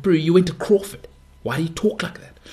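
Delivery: ribbing a mate who sounds too posh; incredulous mock call-out. Mocking a private-school friend, teasing disbelief. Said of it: "You're like".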